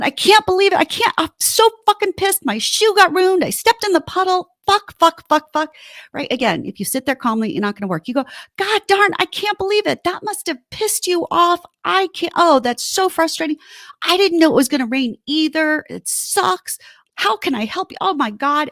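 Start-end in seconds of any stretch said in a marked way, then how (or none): 0.0-5.7 high, loud, venting rant; riled up. Acted. Wife coming home with ruined shoes, riled-up venting. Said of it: "when she's all riled up". 8.6-18.7 high, indignant commiseration; matching her outrage. Acted. Partner joining in on ruined-shoe rage, worked-up sympathy. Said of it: "just meet them, like, go pace with them".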